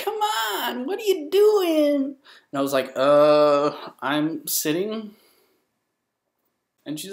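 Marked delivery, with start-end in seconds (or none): 0.0-2.2 very high, drawn-out squeal; hammed-up falsetto whine-shout; loud girl. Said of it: "this girl who was loud". 3.0-5.1 slow, hesitant answer; mock-awkward, drawn out; awkward reply. Retold awkward reply to girl.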